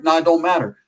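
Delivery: plain reading voice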